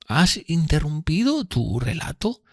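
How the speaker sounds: high, slow, timid question; fearful tone. A narrator's reading. Miss Havisham noticing Pip has stopped, fearful. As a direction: ask it fearfully in a high, drawn-out voice. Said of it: "con el mismo tono de temor".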